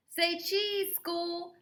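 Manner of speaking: put-on child voice. Ruthie photographing.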